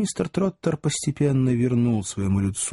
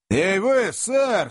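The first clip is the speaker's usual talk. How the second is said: fierce shout; 10 semitones higher, much slower than usual. A narrator's reading. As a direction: call out high and sharp. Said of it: "свирепо крикнул Сэм". (Сэм свирепо окликает незнакомца, резкий высокий крик)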